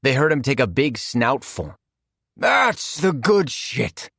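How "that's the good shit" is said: villain relishing. Acted. McCall sniffing in delight.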